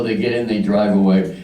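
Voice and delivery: usual speaking voice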